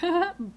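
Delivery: short high playful laugh; a quick put-on "ha-ha" before mischief; mock giggle. Imitates own laugh before bonking.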